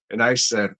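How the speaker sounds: ordinary delivery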